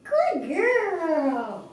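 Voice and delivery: excited high dog-praise voice; puppy praise. Real, not acted.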